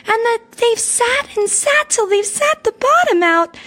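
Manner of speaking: shrill little cub voice, very high; squeaky baby bear. A narrator's reading. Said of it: "squeaked the baby bear in his shrill little voice".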